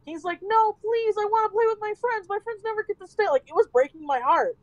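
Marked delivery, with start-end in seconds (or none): 0.4-3.3 put-on high pleading child voice; imitating a kid begging to stay and play. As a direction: beg like a whiny little kid. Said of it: "cry beg".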